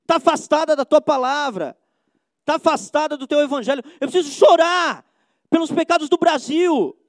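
loud, high, urgent pulpit cry; fiery preaching. Acted. Preacher crying out to God over the nation's sins, loud and fervent. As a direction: plead for the church at full force.

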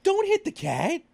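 high, startled parental reprimand; retold scolding. Parent retelling how he told off his kid at the cat, high-pitched scolding.

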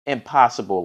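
usual speaking voice

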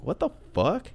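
high-pitched, acted disbelief; mild retold bewilderment. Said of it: "just being like".

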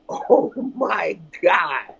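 very slow, drawn-out amazement; awestruck. Real, not acted. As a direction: let it hang in disbelief. Awed by the unreleased album.

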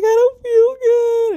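high, loud, drawn-out mock cry; whiny crying baby. Crying to mom over the phone, babyish mock whine. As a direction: imitate yourself whining like a little girl. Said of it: "like a baby".

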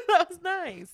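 delighted. Real, not acted. Reacting to a compliment.

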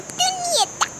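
mucho más agudo y suave, fingiendo ser niña; el lobo disfrazando la voz; falsete del lobo. Acted. Lobo imita a Caperucita. (lobo fingiendo voz de nieta)

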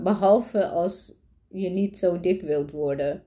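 usual speaking voice

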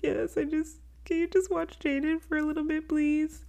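imitating a tearful, high-pitched plea; crying. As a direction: beg for help in a tiny high voice, near tears. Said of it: "I was crying".

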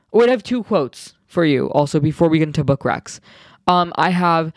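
usual speaking voice

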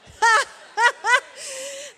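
big burst of high laughter; loud laugh. Real, not acted. Preacher laughs at her own English-accent joke, loud laughter. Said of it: "I don't know why they had English accents".

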